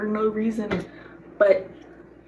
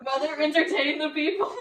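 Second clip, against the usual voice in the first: laughing; 7 semitones higher than usual. Real, not acted. Laughing plea to mother.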